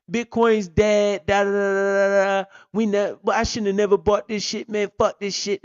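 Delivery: mock the people who sell at the bottom; lightly voiced panicky crypto quitter; mocking whiny seller. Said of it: "everybody's acting like a little bitch".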